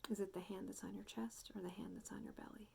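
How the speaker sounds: soft meditation voice with long pauses; guided meditation. Real, not acted. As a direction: gently guide listeners into noticing their breath, unhurried. Guides a breathing check-in.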